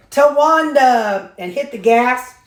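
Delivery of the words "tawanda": drawn-out, higher, louder war cry; battle yell. Retold or imitated. Retelling the movie's parking lot revenge, a drawn-out yell. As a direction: stretch the name out as a triumphant yell. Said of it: "so she yelled out".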